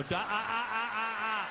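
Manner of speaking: sung piano plink. Retold or imitated. Dad mimicking son's piano song, sung notes. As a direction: plink out a clumsy tune.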